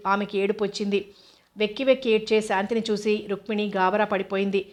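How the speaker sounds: ordinary delivery, nothing marked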